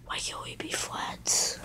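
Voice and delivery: breathy whispered line. Pretend. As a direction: drop to a whisper mid-song.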